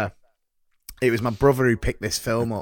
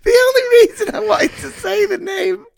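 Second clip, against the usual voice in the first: high, loud laughing voice; 6 dB louder than usual. Real, not acted. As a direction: crack up while talking.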